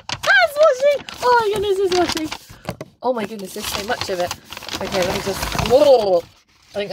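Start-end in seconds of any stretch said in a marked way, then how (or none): 0.1-2.3 delighted squeal. Real, not acted. Opening the tube, surprised, squealing. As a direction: squeal at the discovery. Discovering washi tape.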